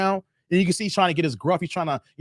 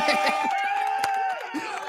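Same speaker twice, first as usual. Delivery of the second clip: high squealing laugh; high squeal of a laugh at the joke; 4 dB quieter than usual. Real, not acted. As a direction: burst out in a high giggle.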